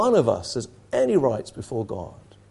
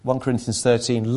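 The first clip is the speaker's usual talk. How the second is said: usual speaking voice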